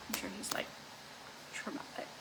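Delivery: hushed aside to the viewers; whispered aside. Real, not acted. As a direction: whisper it so he won't hear.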